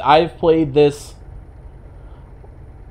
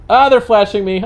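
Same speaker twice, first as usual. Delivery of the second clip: higher-pitched mock alarm; mock complaint; 8 semitones higher, 4 dB louder than usual. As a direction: complain loudly about the flashing. Complaining about oncoming headlights flashing.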